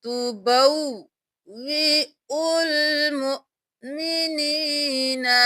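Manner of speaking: slow recitation with a doubled waw; Quranic recitation. Shown as a demonstration. Teacher reciting the verse for students. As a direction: chant तुबव्विउल मुमिनीना, stressing the double waw. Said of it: "अलिफ को भी नहीं पढ़ेंगे".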